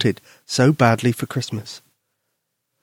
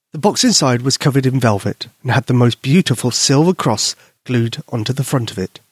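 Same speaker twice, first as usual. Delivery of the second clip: plain reading voice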